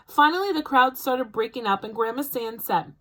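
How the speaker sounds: ordinary delivery, nothing marked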